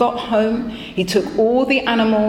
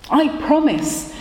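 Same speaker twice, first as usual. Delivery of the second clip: poor man promising; 6 semitones higher than usual. Acted. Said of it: "the poor man said".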